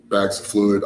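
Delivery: usual speaking voice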